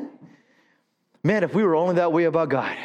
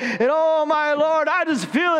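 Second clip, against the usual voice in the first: ecstatic; 7 semitones higher, slower than usual. Acted. Raving about the burger.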